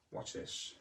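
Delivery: breathy whispered aside. Acted. Drawing viewers in for the trick, whispered. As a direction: hush them in for the trick.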